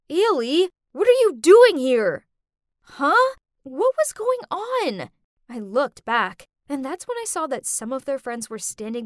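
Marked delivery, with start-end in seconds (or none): 0.1-2.2 raised voice, higher and much louder; loud, startled demand. A narrator's reading. Boyfriend spots his ex at his proposal, loud startled demand. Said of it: "loudly said". 2.8-5.1 high, baffled outburst; bewildered. Acted. Girl confused by the proposal mix-up, bewildered.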